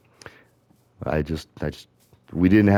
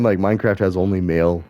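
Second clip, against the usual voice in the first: ordinary delivery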